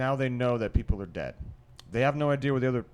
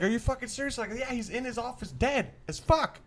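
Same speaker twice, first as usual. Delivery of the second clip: much higher panicked voices; freaked-out exchange; 12 semitones higher than usual. Retold or imitated. Girls and guy trade panicked lines, much higher. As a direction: pitch it way up, freaking out. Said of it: "they're freaking like".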